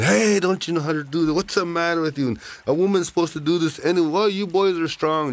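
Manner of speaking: high-pitched, loud scolding; screaming old lady. A narrator's reading. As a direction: holler at useless boys in an old lady's high voice. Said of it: "That old lady was screaming".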